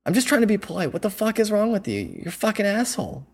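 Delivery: imitating an irate woman, pitched high; high-pitched retold tirade, getting in his face; angry yelling. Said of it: "yelling at my face".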